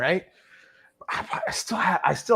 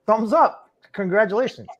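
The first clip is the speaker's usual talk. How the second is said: lightly raised, falsely bright congratulations; mock cheery congrats; 8 semitones higher, 4 dB louder than usual. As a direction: hand her an awkward cheerful "Congratulations". Mock cheerful praise to stranger.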